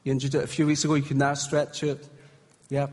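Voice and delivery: ordinary talk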